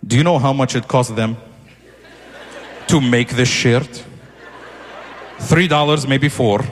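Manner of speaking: low, slow immigrant dad. Retold or imitated.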